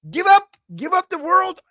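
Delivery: high, loud, urgent order; forceful command. Real, not acted. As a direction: call it out like an exasperated command. Voicing scripture's command in rant.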